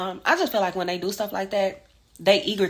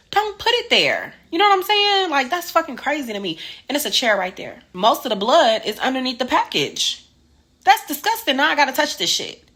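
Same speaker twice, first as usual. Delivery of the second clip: loud, high-pitched indignant rant; exasperated outrage; 6 semitones higher, 7 dB louder than usual. Real, not acted. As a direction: voice leaps up in disbelief and disgust. Ranting about packages left on blood.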